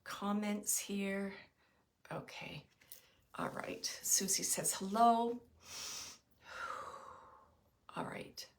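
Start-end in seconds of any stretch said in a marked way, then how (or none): 2.1-8.5 breathy whisper, half to herself; whispered aside. Real, not acted. Reading the stream comments to herself, whispering. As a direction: murmur it under your breath as you look away.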